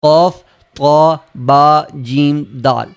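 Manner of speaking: slow letter recital. Shown as a demonstration. Teacher slowly repeating the five letters. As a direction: spell them out slowly and clearly. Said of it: "حروف قلقلہ پانچ پڑھائے".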